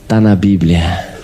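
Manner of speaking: low, slow, smug maid voice with a laugh; imitating the maid. Maid Ted answering the worried preacher, slow low smug voice. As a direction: drag it out like a stubborn maid who knows best. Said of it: "Ela só falava assim".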